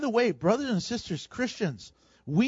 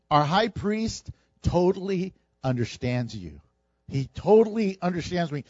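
ordinary talk, nothing marked

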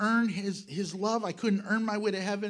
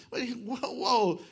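mocking atheist voice; 6 semitones higher, 4 dB louder, slower than usual. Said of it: "the unbelieving world, when, before I was saved".

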